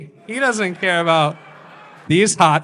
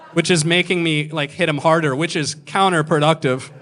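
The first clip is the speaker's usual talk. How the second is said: ordinary talk, nothing marked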